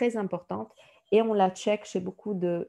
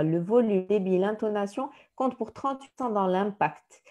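usual speaking voice

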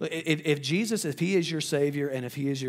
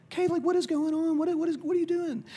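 high, louder anxious parent calling out; worried parent; 3 dB louder than usual. Retold or imitated. Parents finding their crying daughter at night, alarmed high call.

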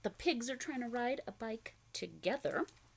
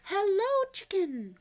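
much higher, louder greeting; Duck calling hello; 10 semitones higher, 8 dB louder, slower than usual. Acted. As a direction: call out as Duck. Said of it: "called Duck".

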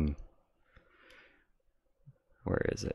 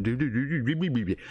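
higher-pitched nonsense "do-do-do" patter; mocking babble; 5 semitones higher, faster than usual. Imitating someone sitting around on the phone, mocking babble. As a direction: babble mindlessly in a raised pitch.

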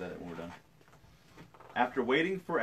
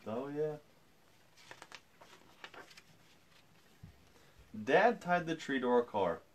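storytelling; 4 semitones higher than usual. A narrator's reading. Reads picture book aloud.

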